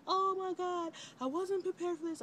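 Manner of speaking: overwhelmed new mother near tears; mother bawling as her baby cries; tearful. Retold or imitated. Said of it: "I just am bawling".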